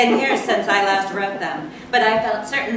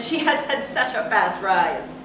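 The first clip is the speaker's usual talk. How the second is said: plain reading voice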